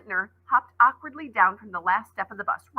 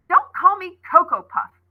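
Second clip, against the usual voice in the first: loud, high, hurt protest; upset cry; 9 semitones higher, 7 dB louder than usual. Acted. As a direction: cry it out near tears. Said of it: "cried Coco".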